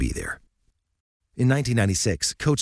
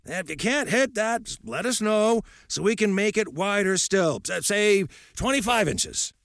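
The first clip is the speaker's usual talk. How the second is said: mock a coach who lowers the bar; coddling coach; 12 semitones higher than usual. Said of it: "They don't say".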